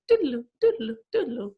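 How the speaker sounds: sung wavering doodaloo; Wayne's World flashback. Retold or imitated. Teller cues a flashback to viewers with a wavering doodaloo. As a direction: hum the dream-sequence jingle.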